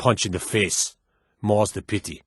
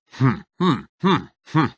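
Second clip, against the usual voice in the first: a few higher closed-mouth hums; idle humming; 4 semitones higher than usual. Acted. Guard humming idly while waiting, higher hums. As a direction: hum absently to yourself.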